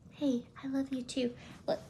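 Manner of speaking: ordinary talk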